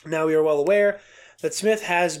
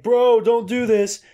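higher, louder, slowed "Bro, don't do this"; mock pushy warning; 6 semitones higher, 6 dB louder, slower than usual. Reading Smith's imagined pushy J J line, a slow mock yell. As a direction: ham up a bossy friend warning him off. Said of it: "He was not like".

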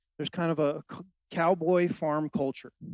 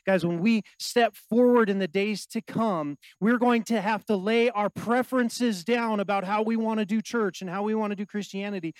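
ordinary talk

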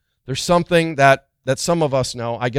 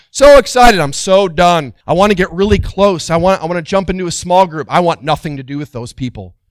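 higher, louder bursts of sudden eagerness; mood swings; 4 semitones higher, 7 dB louder than usual. Retold or imitated. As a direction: swing from gushing to fed up. Acting out an up-and-down believer.

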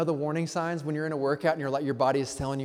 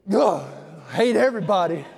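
loud, higher, hammed-up grumble; mock grumpy churchgoer; 3 semitones higher, 9 dB louder than usual. To the congregation, a mock Tuesday grump, loud and fed up. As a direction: grumble it out like you're sick of people.

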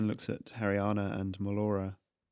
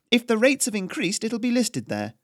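higher, louder, crisp noble lady; cool aristocratic challenge; imperious noblewoman Haryana; 12 semitones higher, 5 dB louder than usual. Acted. Noblewoman Haryana challenges the tax collector, imperious and crisp.

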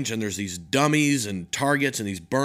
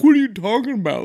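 mock whine; 10 semitones higher, 7 dB louder than usual. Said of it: "Nugent cried the entire way home".

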